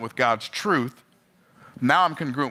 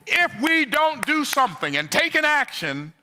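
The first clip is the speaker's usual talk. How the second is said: preaching climax; 14 semitones higher than usual. Real, not acted.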